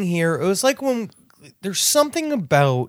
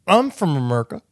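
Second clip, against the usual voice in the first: slurred mock drunk, a little lower; drunken woman; 5 semitones lower than usual. Woman accosting two friends at a late night bar, drunken slur. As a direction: imitate a drunk's garbled speech. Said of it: "the only way to describe it is drunkenese".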